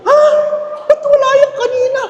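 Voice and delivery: very high, fast squeaky complaint; greedy guest upset dish was missing earlier, high and fast; jealous nosy guest. Acted. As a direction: whine it quickly, miffed you missed it.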